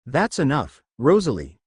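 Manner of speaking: ordinary delivery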